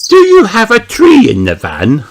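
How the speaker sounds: put-on high, loud little girl piping; Peppa voice. Peppa asking Mister Fox, high eager child voice. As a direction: ask eagerly like a small child.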